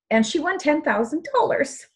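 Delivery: high, tearful squeak of emotion; real crying. Real, not acted. Presenter telling her friend's success story, crying. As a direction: voice climbing as the tears take over. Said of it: "not to be crying during my presentation".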